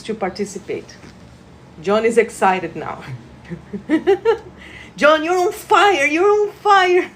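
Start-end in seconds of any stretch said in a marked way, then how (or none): 3.5-4.5 high rising giggle; laughing. Real, not acted. Delighted at John getting excited, laughing. 5.0-7.1 high, excited exclamation. Real, not acted. Healer cheering John on as he joins in, excited. Thrilled at John being on fire.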